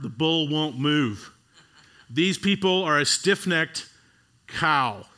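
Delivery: usual speaking voice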